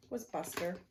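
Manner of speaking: usual speaking voice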